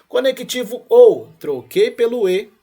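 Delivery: loud, slow stress on "ou" and "E"; emphatic teaching. Shown as a demonstration. To the class, teacher drives home the swap: loud, slow emphasis. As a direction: spell out "Conectivo ou, troquei pelo E" slowly and loudly, pressing each connective.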